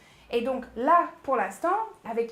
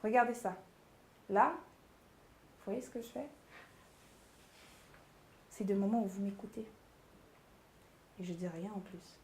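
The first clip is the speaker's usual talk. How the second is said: loaded silences; 3 semitones lower, 7 dB quieter than usual. Shown as a demonstration. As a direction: let each phrase hang, dropping softer. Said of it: "Si je fais une silence".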